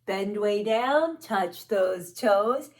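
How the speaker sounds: slow and drawn out. Shown as a demonstration. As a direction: draw the words out slowly. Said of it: "bend down low".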